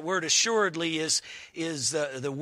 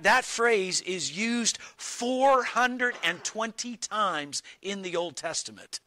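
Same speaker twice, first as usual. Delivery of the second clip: ordinary talk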